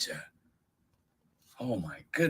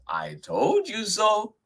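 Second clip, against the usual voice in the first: very high little mouse voice; high-pitched, knowing little creature; smug mouse; 14 semitones higher, 5 dB louder than usual. Acted. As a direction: squeak it as proof. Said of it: "said Mouse".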